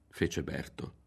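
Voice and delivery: plain reading voice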